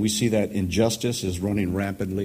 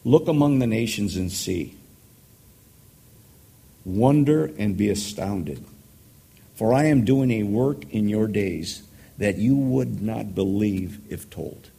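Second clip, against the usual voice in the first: raised, declaiming voice of God's answer; scripture reading; 3 dB louder than usual. Acted. Preacher reading God's answer to Habakkuk, declaiming. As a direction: proclaim it like a prophet.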